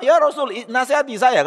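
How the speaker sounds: high-pitched, pressing request; insistent plea. Retold or imitated. A man repeatedly asks the Prophet for advice, insistent plea.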